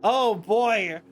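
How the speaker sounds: usual speaking voice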